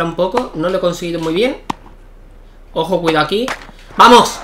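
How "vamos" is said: loud, high shout of triumph; real cheer. Real, not acted. Gamer cheering his tennis point, loud high shout. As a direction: shout it out as you win the point.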